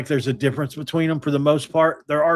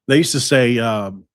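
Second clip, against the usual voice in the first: ordinary talk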